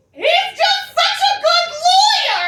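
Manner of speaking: high, loud wailing sob-shout; crying dad. Retold or imitated. Dad crying at the movie, high wailing shout. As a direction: blubber it like a teary dad. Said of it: "he goes".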